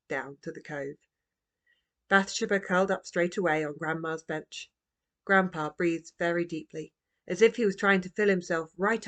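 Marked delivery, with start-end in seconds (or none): none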